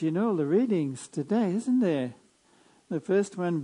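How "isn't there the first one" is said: ordinary delivery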